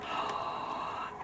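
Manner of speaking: sudden surprised gasp; sharp gasp. Real, not acted. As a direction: gasp in shock. Every card showed Tower.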